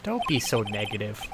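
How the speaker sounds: ordinary talk, nothing marked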